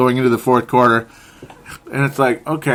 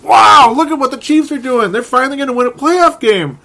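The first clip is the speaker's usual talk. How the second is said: much higher and louder, gushing amazement; excited disbelief; 12 semitones higher, 6 dB louder than usual. Retold or imitated. Fan reacting to the Chiefs rout, high excited awe. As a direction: gasp it out like you can't believe your eyes. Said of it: "I'm like".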